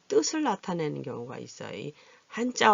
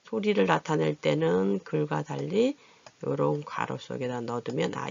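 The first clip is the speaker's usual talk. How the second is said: ordinary delivery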